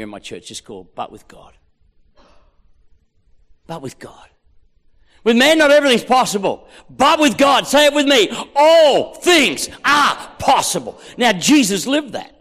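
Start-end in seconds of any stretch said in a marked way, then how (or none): 5.2-11.0 loud, high, emphatic proclamation; forceful preaching. Real, not acted. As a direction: rouse the room to say it along. Preacher leads congregation in verse.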